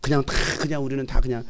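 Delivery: ordinary delivery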